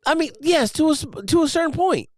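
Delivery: high, loud mocking incredulity; sarcastic disbelief. Real, not acted. Mocking the Master Chief quote.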